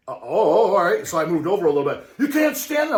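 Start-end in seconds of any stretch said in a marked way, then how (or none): 2.2-3.0 high, louder mock guard order; a higher imitated warning, said again; mock security guard repeating. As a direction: repeat the rule with mock authority.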